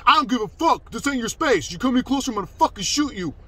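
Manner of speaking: high, loud mocking imitation of a threatening man; loud, imitated aggressor. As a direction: imitate him shouting over everyone. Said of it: "Just loud as fuck".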